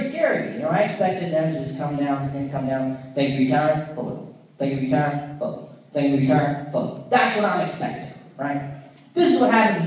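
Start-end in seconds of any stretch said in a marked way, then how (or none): 3.1-7.1 lightly voiced formal greeting, repeated by rote; a low, understated official politeness; very serious president. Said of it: "very serious. interaction".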